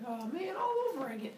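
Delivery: high falsetto complaint; mock whining. Healed fighters must start over, high mock whine. Immortals face fighting again.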